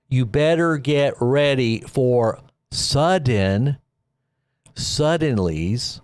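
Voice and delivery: drawn-out, slow, deliberate warning; slow dramatic warning. Real, not acted. Warning his viewers to prepare, slow and drawn out. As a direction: stretch every word so it sinks in.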